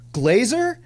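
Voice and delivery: high, questioning call of a name; unsure. Retold or imitated. Man hailing someone he thinks he recognizes, unsure. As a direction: tentatively check if it's really him. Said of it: "I can hear in his voice that he's not sure".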